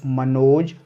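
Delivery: ordinary talk, nothing marked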